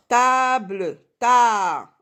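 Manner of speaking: TABLE drawn out high and loud, then TA alone; syllable demonstration. Shown as a demonstration. Teacher isolating the first syllable for pupils, syllable demonstration. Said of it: "La syllabe, première syllabe".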